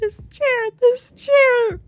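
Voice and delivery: loud, high-pitched raving; crazed shout at the chair; frenzied rant. A narrator's reading. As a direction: scream it as if unhinged.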